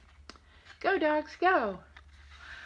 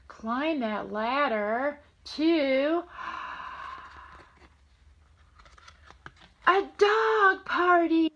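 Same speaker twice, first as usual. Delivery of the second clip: louder and slow, building up; building excitement; 4 semitones higher, 4 dB louder, slower than usual. Acted. Grandma reading to grandson, rising excitement. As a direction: build it up to the big party.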